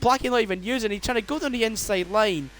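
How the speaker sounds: rising excited race call; motorsport commentator. Acted. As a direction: build the excitement lap by lap. Live race play-by-play.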